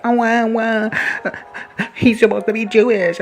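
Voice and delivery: high, loud mock-gasping voice of a scandalised fan; mocking shocked fan. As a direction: ham up a clutching-pearls fan voice. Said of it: "the people are like".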